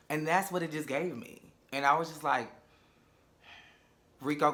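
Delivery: ordinary talk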